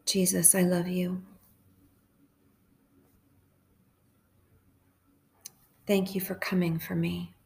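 hushed, even prayer with long pauses; guided meditation prayer. Real, not acted.

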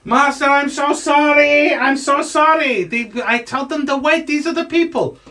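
high, apologetic gnome servant; gnomey voice. Acted. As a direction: plead in a little gnome voice, drawing out the apology. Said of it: "your little gnomey voice".

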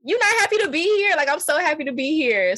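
high, exasperated coworker voice; incredulous scolding. Retold or imitated. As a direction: scold the complainer in disbelief. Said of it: "and it's like".

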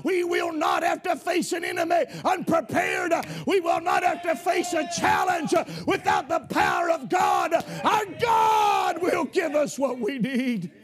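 full-throated revival preaching, rising to a shout; preaching at full force. Real, not acted. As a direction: preach it hard and build the fire.

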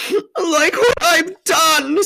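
loud, slow, high sobbing; hammed-up tearful faun; wailed confession. A narrator's reading. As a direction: howl it out in misery. Tumnus wails his confession.

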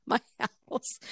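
helpless amused telling; laughing. Real, not acted. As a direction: lose it laughing mid-sentence. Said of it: "reason why I'm laughing".

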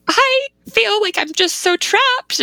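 high, slow mock wail; mock weeping. Pregnant wife telling husband she feels trapped, high mock weeping. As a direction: draw it out in a high, tearful imitation. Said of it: "weeping on the phone".

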